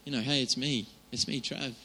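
put-on hushed and familiar, like a child to a dad; quiet, intimate prayer. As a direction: speak softly to God as your father. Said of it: "come to him and say".

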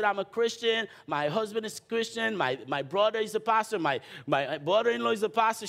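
imitating the eager owner, pitched well up; high woman's voice. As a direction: voice the lady high and keen. Woman voiced in retold story.

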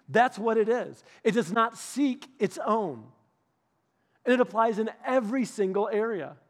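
preaching. Acted. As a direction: drive the point home to the congregation. Preaching builds to climax.

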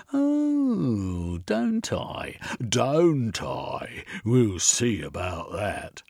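high, taunting threat, then slowing down; menacing inspector. Acted. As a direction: toy with her before the threat.

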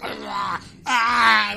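loud, wild "aah" while devouring; open-throated mock-crazed growl; maniac eating noise. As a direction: imitate frantic, crazed gnawing. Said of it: "eating a mango like a maniac".

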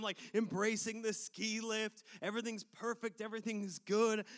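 normal talking voice

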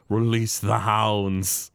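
slow, drawn-out villain command; Burns. Retold or imitated. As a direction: stretch it out like a sinister old boss. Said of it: "And Burns is like".

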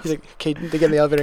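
ordinary talk

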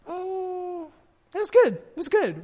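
high, loud overdone praise; forced praise. Retold or imitated. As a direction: fake an enthusiastic compliment. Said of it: "We're like".

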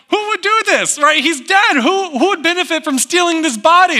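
high, frantic panicked question; panicking women. Acted. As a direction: ask in rising panic, voice up high. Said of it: "They're panicking".

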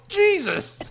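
high startled shout; shouted Jesus. Retold or imitated. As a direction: yell it out in shock. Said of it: "and shouts".

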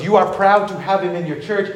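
usual speaking voice

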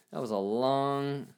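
usual speaking voice

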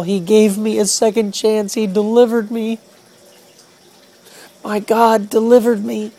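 crying out in joyful relief; yelling with joy. Retold or imitated. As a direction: shout it out in tearful triumph. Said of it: "I just began to yell".

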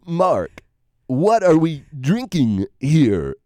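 a light take on Walken's halting cadence, slowed down; a hint of Christopher Walken, slow and deadpan; lightly voiced Walken impression. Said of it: "I wanna hear Christopher Walken".

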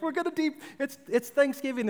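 imitated high-pitched excited mom. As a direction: mimic a mother buzzing with holiday excitement. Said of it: "you can hear her excitement".

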